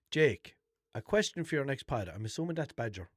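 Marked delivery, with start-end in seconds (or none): 0.1-2.1 reading out message. Shown as a demonstration. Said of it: "I have a message here".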